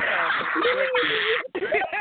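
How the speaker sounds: high squealing laugh; real squealing laugh. Real, not acted. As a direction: shriek with laughter. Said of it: "You gonna be a part".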